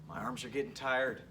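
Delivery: normal talking voice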